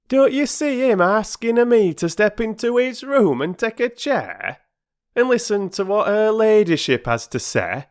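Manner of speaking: a proud man airing his hurt; aggrieved porter. Acted. Perks complaining to the children, aggrieved.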